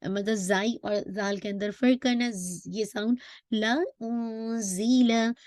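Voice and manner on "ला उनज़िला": slow chant with the ज़ buzzing clearly; Quran recitation. Shown as a demonstration. Teacher recites the verse with a clear whistling z, slow chant. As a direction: recite slowly, sharpen the ज़. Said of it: "whistling मद वाज़े करना है".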